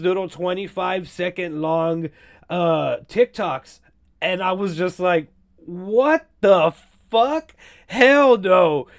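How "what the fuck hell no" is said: drawn-out, higher-pitched incredulous outrage; pissed-off disbelief. Retold or imitated. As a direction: stretch the question, then refuse firmly. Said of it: "that actually fucking pissed me off".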